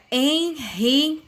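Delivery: enriquecer split as en, ri, que, cer; four separate beats on enriquecer; syllable by syllable. Shown as a demonstration. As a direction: pause after each syllable of enriquecer. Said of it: "Podemos dividir as palavras em sílabas".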